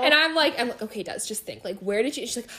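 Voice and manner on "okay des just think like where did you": soft, careful calming voice; retold hushed reassurance; trying to be calm. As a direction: talk her down quietly. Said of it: "trying to be calm".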